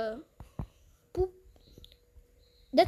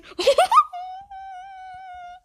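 loud squeal trailing into a long high note; high shrieking laugh; 3 dB louder than usual. Real, not acted. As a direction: let out a startled laughing shriek. Reacts after a scare.

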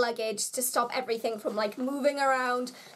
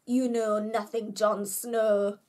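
a bad, half-hearted northern English accent; clumsy northern English accent on a T-shirt quote; terrible northern accent; 3 semitones lower, slower than usual. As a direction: try a northern accent and fall short. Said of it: "That was a terrible attempt at a northern accent".

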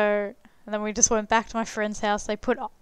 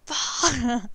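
half-hearted wail that tips into a laugh; retold pain cry; 3 semitones higher than usual. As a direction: act out a sting of pain, playfully. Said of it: "I'm just crying and screaming".

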